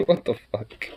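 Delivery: normal talking voice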